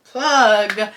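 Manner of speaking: jokey self-promo call-out; playful shout. Real, not acted. Vlogger plugs her own podcast, ending in a loud call. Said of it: "plugging her podcast".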